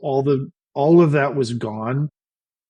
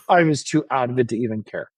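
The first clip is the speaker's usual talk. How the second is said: ordinary talk, nothing marked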